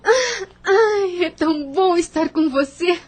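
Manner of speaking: suspiro alegre, mais agudo e mais forte; abraça a amiga, feliz; alegria. Acted. (Lúcia abraça Ana, suspirando de alegria)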